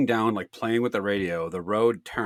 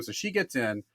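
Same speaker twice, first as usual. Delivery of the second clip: ordinary talk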